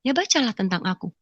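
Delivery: very soft retold answer; hushed, restrained instruction; quoted mysterious voice. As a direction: answer quietly as the unseen voice. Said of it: "suara itu cuma bilang".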